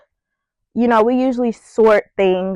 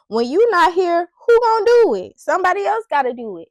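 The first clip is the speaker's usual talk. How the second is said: high-pitched rhetorical challenge; sassy challenge; 7 semitones higher, faster than usual. Retold or imitated. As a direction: throw the question out high and sassy. Mock challenge to herself.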